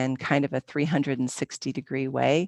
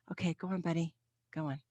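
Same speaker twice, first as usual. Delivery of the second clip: quiet aside; 7 dB quieter, much faster than usual. Real, not acted. Yoga teacher interrupts class to move buddy along, quiet aside. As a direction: softly shoo a buddy away.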